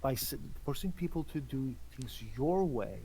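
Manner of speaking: slow, solemn leader voice; grave knight. Acted. Gaius speaks gravely to Torden.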